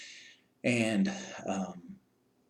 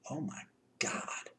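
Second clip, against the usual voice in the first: hushed and slow, under the breath; whispered shock at the sight of it; stunned whisper; 6 semitones higher, 7 dB quieter, slower than usual. Retold or imitated. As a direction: barely breathe it out in disbelief. Stunned at the scene.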